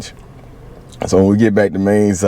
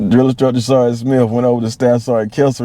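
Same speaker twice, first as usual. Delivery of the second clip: ordinary talk, nothing marked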